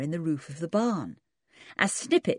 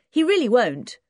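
George insisting; 7 semitones higher, 6 dB louder than usual. Acted. George reassuring.

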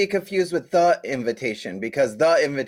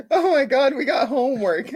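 high laughing excitement; excited, laughing; 11 semitones higher, 3 dB louder than usual. Real, not acted. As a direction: blurt it out with a laugh. Excited about assigning homework.

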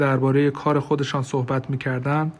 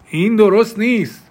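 raised, higher-pitched protest; loud objection; 7 semitones higher, 6 dB louder than usual. Acted. Mr. Lane objecting to the verdict in court, loud. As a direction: call it out loudly over the murmuring room. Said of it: "با صدای بلند گفت".

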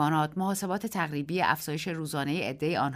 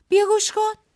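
high, loud cry; shout; 10 dB louder than usual. A narrator's reading. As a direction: call out urgently. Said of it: "فریاد کشید". (فریاد بلند و فوری)

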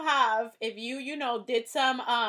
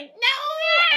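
high, loud wail of no; dramatic no; 10 dB louder than usual. Retold or imitated. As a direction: cry out no in fan despair. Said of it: "that's when I was like".

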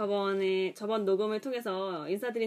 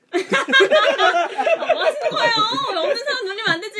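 laughing; 11 semitones higher, 9 dB louder than usual. Real, not acted. Reacting to being teased.

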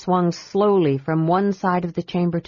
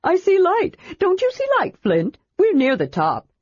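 quick, higher eager reassurance; hurriedly; 12 semitones higher than usual. A narrator's reading. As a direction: rush to change the subject. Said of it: "Tass added hurriedly".

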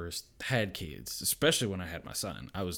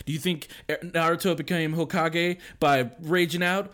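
higher, louder mock pep talk; Naruto pep talk; 6 semitones higher, 6 dB louder than usual. Dad to raging kid, mock inspirational speech. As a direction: lecture like an anime mentor. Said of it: "it's like".

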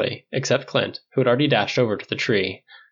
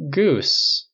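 mildly awkward finish; 4 semitones higher than usual. A narrator's reading. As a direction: finish the thought uncomfortably. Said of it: "Steve finished awkwardly".

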